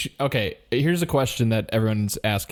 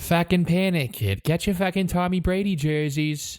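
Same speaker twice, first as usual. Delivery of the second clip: put-on Boston accent; 5 semitones higher than usual. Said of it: "Boston accent that we can do".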